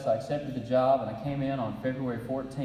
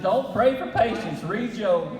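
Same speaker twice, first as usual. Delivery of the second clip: much higher and louder, slow punchy advice; emphatic quoted warning; 10 semitones higher, 7 dB louder, slower than usual. Retold or imitated. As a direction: boom it out like a wry old elder. Quoting an elder's advice.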